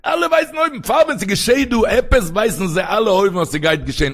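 high, loud opening that gradually comes down; impassioned preaching. Acted. Rabbi telling listeners what souls know above, impassioned preaching.

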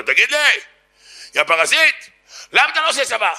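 high, mock-aggressive yell; accosting yell. Secular passerby yelling at a yeshiva student, accusing. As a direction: call him out, pitch climbing sharply. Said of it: "צועק לו".

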